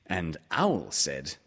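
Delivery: usual speaking voice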